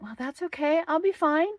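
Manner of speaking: chirpy self-reassurance; high naive voice. Retold or imitated. Retold own naive thought.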